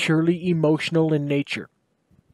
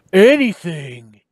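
louder, slow ringing shout; ringing affirmation; 3 semitones higher, 7 dB louder, slower than usual. Acted. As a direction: proclaim it in ringing tones. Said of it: "he affirmed in ringing tones".

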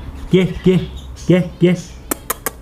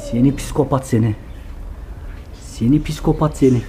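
ordinary talk, nothing marked

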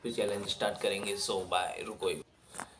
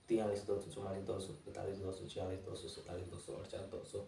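low-energy, soft monotone rattle of numbers; speedy counting; 3 semitones lower, 9 dB quieter than usual. Real, not acted. Challenger counting toward one lakh without stopping, speedy soft count. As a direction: count briskly and quietly, number running into number. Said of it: "थोड़ी speed तो रहेगी".

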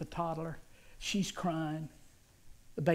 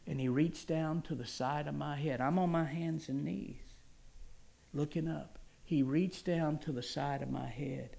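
normal talking voice

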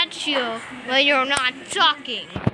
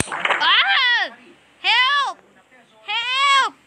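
higher-pitched playful yelps for help; mock cry for help; 6 semitones higher, much slower than usual. Game character trapped by a monster, playfully yelping for help.

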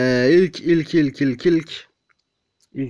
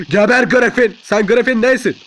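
loud, higher taunt; angry battle shout; 6 semitones higher, 5 dB louder than usual. Real, not acted. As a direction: yell it at the enemy you attack. Attacking enemy Griffin.

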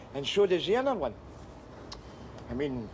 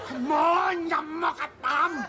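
urgent shouting; 9 semitones higher than usual. Acted. Rescuer shouts at the half-drowned man to wake up.

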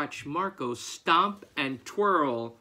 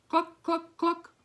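high, short clucks; hens clucking; 4 dB louder than usual. A narrator's reading. Farmer listening at coop door hears high clucking. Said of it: "gentle clucking".

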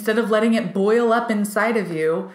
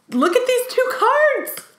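much higher, slowed eager exclaim; slower than usual. Real, not acted. Reader holding up cards, high eager exclaim. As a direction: show them off with wonder.

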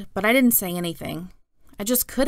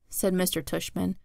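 ordinary delivery, nothing marked

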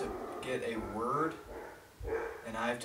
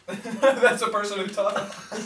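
giggling through the words; laughing; 8 semitones higher, 6 dB louder, slower than usual. Real, not acted. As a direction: laugh while you explain. Giggling while explaining.

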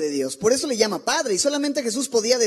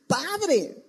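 crying out; 5 semitones higher than usual. Pretend. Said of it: "clamar y decir".

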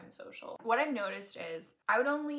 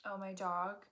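usual speaking voice

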